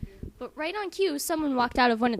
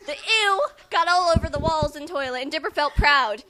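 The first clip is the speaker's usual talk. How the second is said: louder, mock-dramatic reading; 8 dB louder than usual. Reading bad fan fiction to her friend, loudly. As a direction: belt out the gross bit. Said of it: "Why are you so loud".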